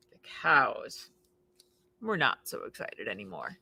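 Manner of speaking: low, soft whisper of disappointment; deflated hush. A narrator's reading. Narrator telling the cows lost their joy, hushed and low. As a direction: let the air out of the excitement.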